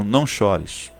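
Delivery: a hushed, gentle consolation; barely above a murmur, soothing; tender comfort. Acted. Jesus quietly comforts the crying listener, hushed.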